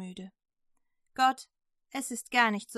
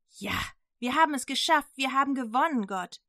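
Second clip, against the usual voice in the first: triumphant cheer; 4 semitones higher, 5 dB louder than usual. Acted. Elia jubelt nach dem Sieg.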